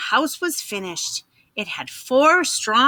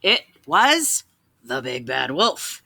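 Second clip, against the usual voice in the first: ordinary delivery, nothing marked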